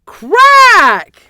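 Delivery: a sudden, loud high crack. A narrator's reading. Narrator bursting out the nest's sudden crack, loud. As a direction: burst out the crack like the nest splitting. Nest breaking.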